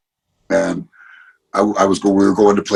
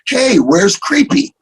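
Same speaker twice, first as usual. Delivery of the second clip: put-on high, slow little-girl voice; 14 semitones higher, 4 dB louder, slower than usual. A little girl on a New York street calling to the tattoo artist, high and slow. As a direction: sound like an eager four-year-old asking. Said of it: "she looks at me and she's like".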